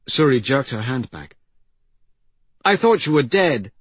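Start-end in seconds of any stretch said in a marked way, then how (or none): 2.6-3.7 high, loud startled outburst; startled girl. Acted. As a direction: blurt it out in shock. Startled girl to dying woman.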